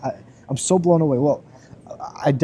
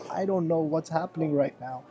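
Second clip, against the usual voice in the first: higher, softer, mildly tearful imitation; hushed, overwhelmed bewilderment, retold; retold heavier crying; 4 semitones higher, 5 dB quieter than usual. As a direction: lightly act someone overcome with tears. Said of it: "went into another level of crying".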